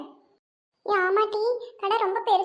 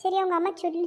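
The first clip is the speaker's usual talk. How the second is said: ordinary talk, nothing marked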